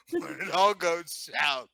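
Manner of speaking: laughing. Real, not acted. Player picturing the sorcerer running away, laughing. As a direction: finish the thought while cracking up.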